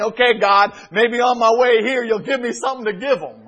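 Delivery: a hint of pastor begging God last minute; mock panicked prayer. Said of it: "praying and saying".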